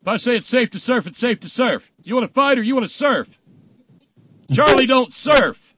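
high, barked shouting; loud, punchy film quote, last line stretched out; war-movie commander. Acted. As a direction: bellow it like an officer overruling his men. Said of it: "isn't that an Apocalypse Now reference?".

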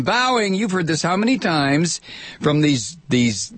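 ordinary delivery

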